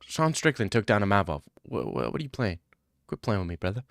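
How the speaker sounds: ordinary talk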